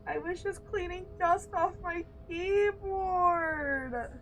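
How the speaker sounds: high, slow, drawn-out lament; real anguish; anguished wail. Real, not acted. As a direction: plead with the universe, stretched out. Lost progress, complaining.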